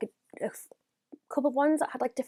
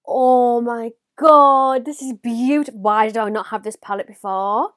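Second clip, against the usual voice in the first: loud swoon; 8 dB louder than usual. Real, not acted. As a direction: stretch out the wonder, loudly. Swooning over palette.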